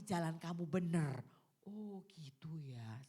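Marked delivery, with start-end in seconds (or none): 1.6-3.0 slow, soft half-whisper; murmured slowly, much softer; hushed realization. Acted. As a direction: let it sink in, almost under the breath.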